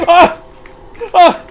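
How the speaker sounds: sharp high screams; startled yells. Real, not acted. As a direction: yelp in alarm. Getting sucked up in game.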